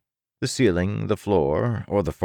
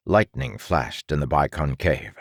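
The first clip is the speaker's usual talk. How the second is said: ordinary delivery, nothing marked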